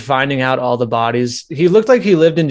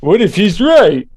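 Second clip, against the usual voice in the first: louder, higher goofy mimicry; mocking echo; 5 semitones higher, 5 dB louder than usual. Friend mocking the what-if question, laughing goofy voice. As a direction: parrot it back through laughter, hammed-up.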